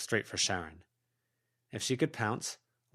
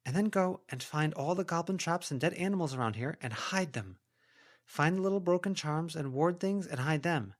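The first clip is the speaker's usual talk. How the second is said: higher, coaxing character voice; heroine coaxing a goblin; 9 semitones higher than usual. A narrator's reading. Heroine persuading a goblin to hunt down traps, coaxing. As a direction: sweet-talk the goblin into helping. Voices Verona giving orders.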